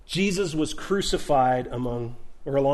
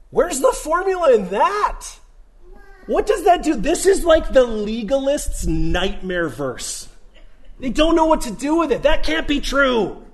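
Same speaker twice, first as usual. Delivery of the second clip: high, loud incredulous questions; 9 semitones higher, 6 dB louder than usual. Real, not acted. Preacher mocking legalists, high and incredulous. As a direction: press the absurdity hard.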